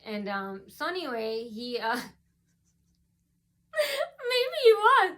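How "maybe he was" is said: laughing. Real, not acted. Laughing while telling.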